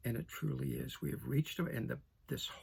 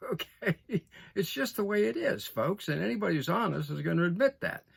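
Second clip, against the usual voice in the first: raised, laughing, pitch up; louder laughing aside; 5 semitones higher, 6 dB louder than usual. Real, not acted. Speaker to viewers, louder with a laugh. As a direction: push it out with a laugh to the folks.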